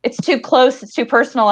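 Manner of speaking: usual speaking voice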